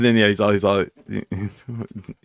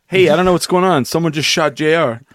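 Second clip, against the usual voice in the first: movie quote voice; 6 semitones higher, 3 dB louder than usual. Retold or imitated. Quoting movie scene, loud.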